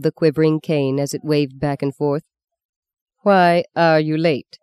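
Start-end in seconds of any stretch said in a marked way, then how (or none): 3.2-4.6 stern nun repeating, slow and louder; nun repeats her demand, stern and slow. A narrator's reading. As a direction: press the question again, slowly. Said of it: "she repeated".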